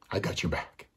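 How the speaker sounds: put-on a player quietly repeating his promise to the boy; soft repeat. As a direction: drop the volume and mean it. Player reassures boy, repeated softly.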